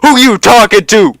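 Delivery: high, loud indignant shriek; mock furious comeback. Imagining telling off the prisoner in the ER, loud high shriek. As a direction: snap back at the prisoner, outraged. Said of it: "I'd be like".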